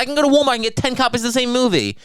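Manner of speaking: high whiny mock voice; mocking Anthony. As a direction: squeak it out like a petulant kid. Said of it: "It's like".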